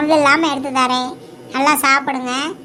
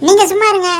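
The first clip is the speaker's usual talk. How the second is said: sharp retort; 6 semitones higher, 5 dB louder, faster than usual. Acted. Mother snaps at husband.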